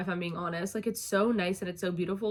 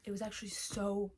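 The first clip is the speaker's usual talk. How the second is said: normal talking voice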